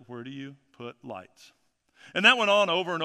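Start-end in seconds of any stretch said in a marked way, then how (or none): 0.1-1.5 soft, slow, lower-pitched pastor's line; measured, hushed retold question; simple, understated question. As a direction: repeat the pastor's question quietly and slowly. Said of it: "he said simply".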